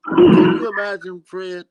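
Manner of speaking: loud, insistent repeat. Real, not acted. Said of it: "I said".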